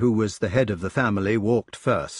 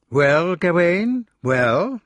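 slow, high and louder, drawn out; drawn-out greeting; 8 semitones higher, 5 dB louder, much slower than usual. A narrator's reading. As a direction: draw out the greeting. Brother greeting Gawain.

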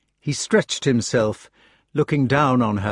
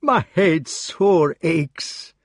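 slow, tearful Scottish moan; 7 semitones higher, much slower than usual. Acted. Gawain tearfully complaining to Arthur in a Scottish burr. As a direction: let the ache break through the words. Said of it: "he added tearfully".

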